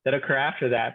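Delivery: ordinary talk, nothing marked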